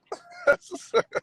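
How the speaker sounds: a sudden, very high squealing laugh. Real, not acted. Friend laughs at being called out.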